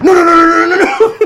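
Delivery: high, loud, rapid string of noes; hammed-up panic, firing off each no; frantic mock protest. Storyteller getting between singer and bathroom, frantic mock protest. Acting out rushing to intervene.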